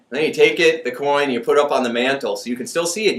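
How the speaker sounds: ordinary talk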